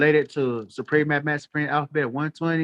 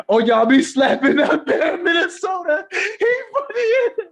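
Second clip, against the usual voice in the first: laughing hard; 14 semitones higher, 5 dB louder than usual. Real, not acted. Cracking up at a comment.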